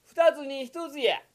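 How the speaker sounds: high, loud jeering merchant voice; taunting shopkeeper. Acted. Kokichi taunts the yokozuna. (甲高く挑発する商人の声)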